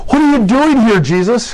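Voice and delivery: high pitched alarmed What are you doing here; a cornered spirit shrieking at Jesus; shrill demon question. Shown as a demonstration. Demon shrieks at Jesus, high and alarmed. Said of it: "might be a way we'd say it".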